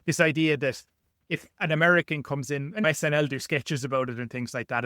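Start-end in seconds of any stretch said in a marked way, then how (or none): none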